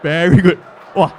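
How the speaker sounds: loud sarcastic praise; hammed-up backhanded compliment; mock praising friends. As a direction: Chinese friends mockingly praising [name]. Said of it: "my Chinese friends".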